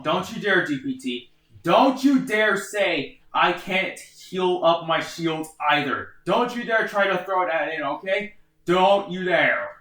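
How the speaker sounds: don't you dare, slow and firm; stern warning. Real, not acted. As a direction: warn them off, slow and insistent. Warning the AI off a restriction.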